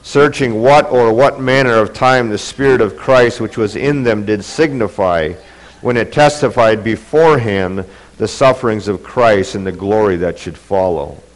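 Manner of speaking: normal talking voice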